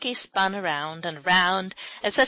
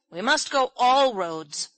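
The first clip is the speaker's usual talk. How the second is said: slow, louder, decisive statement; firm, measured resolve; 5 semitones higher, 6 dB louder than usual. A narrator's reading. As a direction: lay it down firmly, word by word. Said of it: "said Ben firmly".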